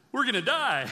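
mock panic. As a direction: wail it in playful terror. Manoah panicking after the vision.

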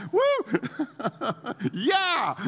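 high, loud, laughing cheer; joyful whoop. Retold or imitated. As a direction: whoop like you're leaping for joy. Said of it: "jumping up and down".

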